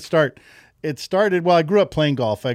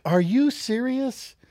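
higher, drawn-out disbelief; mock incredulity; 10 semitones higher, much slower than usual. Son confronting his grumbling golfer dad, mock incredulous. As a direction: mildly scold in exaggerated disbelief.

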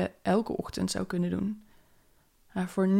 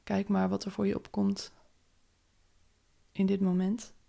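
calm, unhurried meditation voice; guided meditation. Acted. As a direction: guide them gently into the day. Leads a morning meditation. (rustige meditatiestem)

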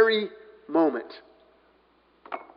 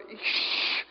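sharp hushing "shh"; stern shush; 9 dB quieter than usual. Acted. Crowd silencing the blind beggar, stern shush. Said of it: "many were sternly telling him to be quiet".

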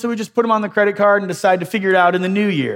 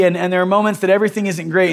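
usual speaking voice

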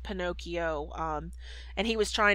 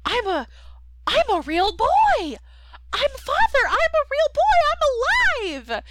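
put-on much higher and louder, squeaky little-boy voice; excited Pinocchio; 8 dB louder than usual. Pinocchio protesting to his father he is alive, very high and excited. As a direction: shriek it like a giddy puppet kid. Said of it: "And then he's like".

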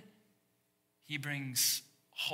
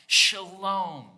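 a loud, higher, playful Shalom with a mock Hebrew accent; hammed-up Hebrew accent; 5 semitones higher, 8 dB louder than usual. Shown as a demonstration. Pastor demonstrating to the congregation, playful mock Hebrew accent. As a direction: show off a mock Hebrew accent to sound smart. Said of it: "but just act like it".

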